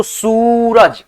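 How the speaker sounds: सूरज stretched as "sooo-raj"; drawn-out vowel. Shown as a demonstration. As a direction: linger on the "oo" of सूरज before "raj". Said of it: "खींच करके उच्चारण होता है". (सूरज का 'सू' लंबा खींचते हुए)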